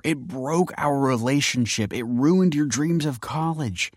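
high, anguished explaining; pleading confession. A narrator's reading. Kelsey explains to Michael why she did it, pleading. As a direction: plead to be understood.

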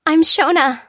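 high, loud, urgent introduction; stunned young woman. A narrator's reading. Stunned stranger introduces herself.

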